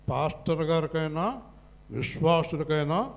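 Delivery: ordinary talk, nothing marked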